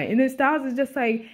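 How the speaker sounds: usual speaking voice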